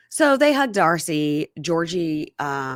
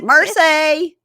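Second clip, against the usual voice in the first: loud high mocking Merci!; mocking Dave; 11 semitones higher, 8 dB louder than usual. Husband mocking wife's French, loud high Merci!. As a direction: bellow Merci! like a teasing husband. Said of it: "And Dave's like".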